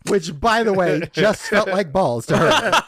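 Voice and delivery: slow, loud words through laughter; real laughter in the voice; laughing while talking. Real, not acted. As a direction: push the joke out through giggles.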